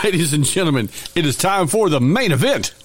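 booming circus ringmaster announcement. Acted. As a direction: announce it like opening the big top. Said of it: "ladies and gentlemen, boys and girls, children of all ages".

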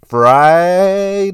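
sing-out hype announcer call; drawn-out hype cry. Real, not acted. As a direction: stretch the word out high and loud. Draws out the day as a hype cry.

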